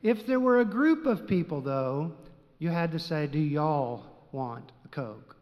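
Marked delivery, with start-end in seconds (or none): 3.3-5.4 slow, lower Texas drawl on "y'all"; Southern y'all. Shown as a demonstration. Preacher to his congregation, showing how Texans offer a Coke, in a slow Southern drawl. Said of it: "It's you plural".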